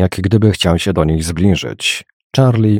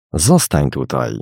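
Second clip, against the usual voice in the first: ordinary delivery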